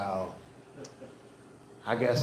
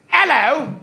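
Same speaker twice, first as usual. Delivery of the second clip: high, loud sing-song British "Hello."; hammed-up British greeting; mock British hello; 8 semitones higher, 8 dB louder than usual. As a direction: chirp "Hello." like a cheery Londoner. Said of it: "Everybody sounds like that over there".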